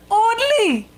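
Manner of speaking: ordinary delivery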